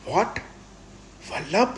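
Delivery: high, loud, sharply rising question; astonished. A narrator's reading. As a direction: blurt it out in shock. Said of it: "જીગર આશ્ચર્યથી બોલી ઉઠ્યો".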